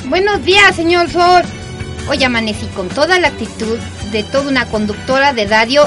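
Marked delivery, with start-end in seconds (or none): 0.1-1.4 cheerful greeting. Acted.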